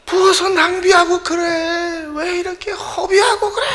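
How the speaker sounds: shrill, high, scolding rant that builds to a shout; 높고 날카롭게 화내며 소리치는 유다 흉내; mocking angry outburst. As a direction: play Judas berating Mary for wasting the perfume, voice climbing. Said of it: "화를 내면서 소리를 치고".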